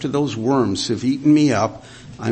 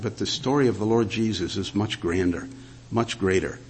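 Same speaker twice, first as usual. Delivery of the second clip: softer, hushed sincere appeal; quiet reflection; 3 semitones lower, 6 dB quieter than usual. Acted. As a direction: draw the congregation into the moment gently.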